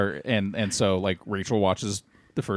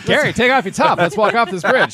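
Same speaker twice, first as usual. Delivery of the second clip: high, loud, laughing call; excited mock quote; 10 semitones higher, 8 dB louder than usual. Co-host retells line to Carrie, high laughing mock shout.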